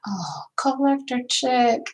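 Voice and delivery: Oh, collector chick whispered slowly; whispered greeting. Real, not acted. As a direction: murmur the name tenderly. Reading a viewer's name softly.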